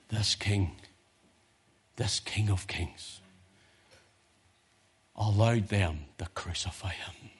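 low, soft, reverent declaration; hushed solemn preaching. Real, not acted. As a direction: let each phrase settle in long pauses.

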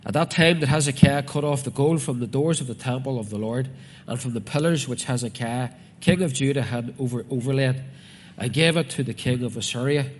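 loud, declaimed Bible reading; preacher reading out scripture. Acted. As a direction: proclaim the verse firmly to the congregation. Reads scripture aloud to congregation.

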